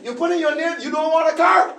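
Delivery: acted-out emphatic persuasion; insistent salesman. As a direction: urge him to stay, voice lifted. Salesman urging him back to his seat.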